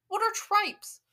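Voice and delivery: high, loud, incredulous question; real puzzled exclamation; baffled outburst. Real, not acted. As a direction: demand to know, bewildered. Baffled by an unknown word.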